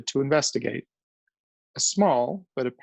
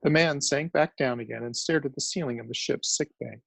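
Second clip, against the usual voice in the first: ordinary delivery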